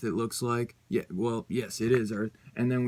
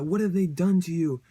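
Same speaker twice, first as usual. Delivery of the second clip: higher and louder, stricken; anguished cry; 6 semitones higher, 5 dB louder than usual. Acted. Betsy cries out to her controlled brother, anguished. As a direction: cry out at your changed brother.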